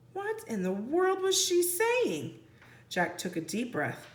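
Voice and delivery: ordinary delivery, nothing marked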